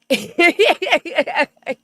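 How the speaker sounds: loud, high burst of laughter; a sudden high laugh. Real, not acted. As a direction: crack up suddenly. Bursts out laughing mid-thought.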